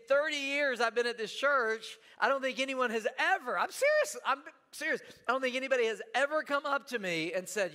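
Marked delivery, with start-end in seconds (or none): 3.6-5.0 insisting. Real, not acted. Emphatic aside.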